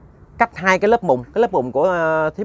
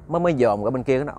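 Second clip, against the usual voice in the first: plain reading voice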